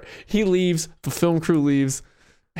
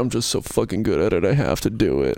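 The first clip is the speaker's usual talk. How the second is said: low, resigned magician deadpan; upset David Blaine; 10 semitones lower than usual. Acted. David Blaine who hates being a magician, low and resigned. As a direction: sink lower as if resigned. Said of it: "really is upset that he is a magician".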